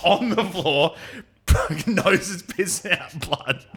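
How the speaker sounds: real laughter breaking into the words; laughing through it. Real, not acted. Telling a mate about his bloody nose, laughing. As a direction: chuckle out the gory part, slowed and high.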